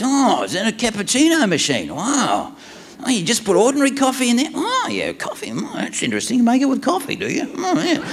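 high-pitched childlike wonder, imitated; hammed-up excited fascination. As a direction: gasp and marvel at every ordinary thing. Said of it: "he's so excited".

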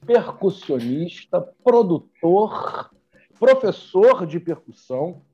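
slow, booming talk show host announcement; TV show intro. Acted. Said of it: "aquela introduçãozinha de televisão".

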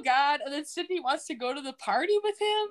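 ordinary talk, nothing marked

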